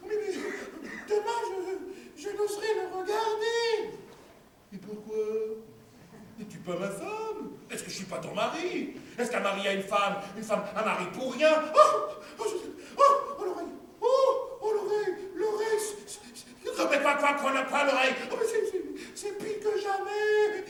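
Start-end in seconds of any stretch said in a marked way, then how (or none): 0.1-4.0 wife fretting. Acted. Wife's voice, fretting. 11.7-20.6 high, wailing woman's voice; wife's high moaning. Acted. Wife moaning to her husband about her ear, high.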